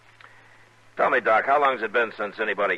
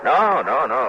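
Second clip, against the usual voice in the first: louder, higher hasty no's; quick denial; 5 semitones higher, 6 dB louder, slower than usual. Acted. Marshal denying he's troubled, loud and hurried. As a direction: brush it off too fast.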